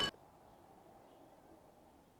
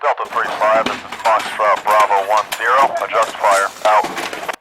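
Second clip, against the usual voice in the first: over the radio readback; steady voice through a field radio; 5 semitones lower than usual. Acted. Fire direction center reads back.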